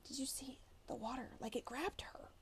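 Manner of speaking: hushed breathless whisper. Acted. Kids whisper to each other about the water, a whisper. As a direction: whisper it like a secret. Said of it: "Some of the kids were whispering".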